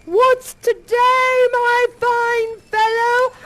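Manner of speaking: put-on delighted old man calling down; joyful shout. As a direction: hail the boy with delight. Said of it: "And Scrooge says".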